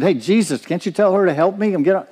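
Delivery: high, fast, mildly whiny complaint; a busy sister griping to Jesus; complaining Martha. Retold or imitated.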